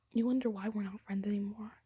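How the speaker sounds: soft whisper to the camera; whispered aside. Real, not acted. Confiding to viewers about the ex-friend, whispered. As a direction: confide it under your breath.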